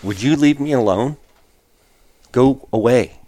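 a hint of a fed-up hunter shooing off a chatterbox; annoyed brush-off. Dar shooing off his chatty glassing partner, mock annoyed. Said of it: "He's like".

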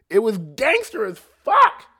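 loud, high burst of admiration; real fired-up exclamation; emphatic shout. Real, not acted. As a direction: holler it, punching the last word. Gushing over the ad lib.

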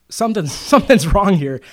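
half-laughing as you realise it; amused disbelief breaking into a laugh. Retold or imitated. Retold inner thought, laughing.

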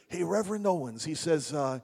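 put-on loud, high Brooklyn accent; a thick Brooklyn guy talking big. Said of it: "this really thick Brooklyn accent".

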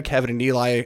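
usual speaking voice

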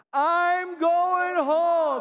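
high, very slow ringing call; preached refrain. Real, not acted. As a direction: hold each word out in defiance. Refrain aimed at the devil.

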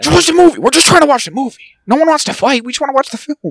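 high, whiny pleading; mock whiny plea. Mocking Jack pleading with the loud man, high and whiny.

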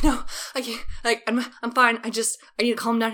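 lightly acted insistence of being fine; panicked protest. Student protests to theater director, mildly panicked.

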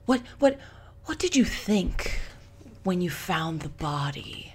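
lightly voiced true-crime host; Phoebe Judge impression. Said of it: "imitations of her".

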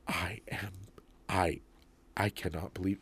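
mock indignant whisper; hushed offended disbelief. Offended customer to the salesman, mock hushed disbelief. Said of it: "And she goes".

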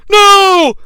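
high, loud anguished shout; shattered Vince. Retold or imitated. Announcer seeing the president flattened, anguished shout. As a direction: shout it heartbroken. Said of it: "Vince McMahon is shattered".

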